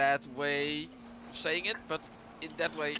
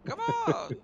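high mock protest. Real, not acted. Protesting the fix.

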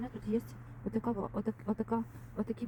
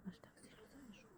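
soft whisper to the dog; 4 semitones higher, 24 dB quieter than usual. Real, not acted. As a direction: breathe the words out.

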